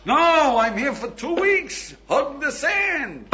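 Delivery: high, loud, stubborn insisting, last words slow; stubborn vacationer. Retold or imitated. To his inner nag, a vacationer's high stubborn refusal. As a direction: dig in your heels and drawl it out.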